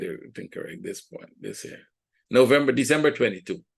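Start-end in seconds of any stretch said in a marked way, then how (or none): 0.0-1.8 under the breath, to himself; muttered note to self; whispered aside. Real, not acted. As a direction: whisper it while checking the dates. Muttering to himself while checking chart.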